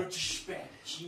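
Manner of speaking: light Felipe Neto impression; squeaky youtuber catchphrase. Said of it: "eu já tô imitando o Felipe Neto".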